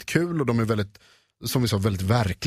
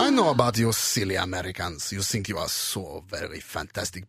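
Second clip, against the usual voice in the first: mock German villain, sinking lower; German accent; 4 semitones lower, 3 dB quieter than usual. Shown as a demonstration. German villain addresses silly Americans, mock German accent.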